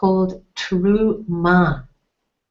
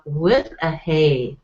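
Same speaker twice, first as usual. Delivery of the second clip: ordinary talk, nothing marked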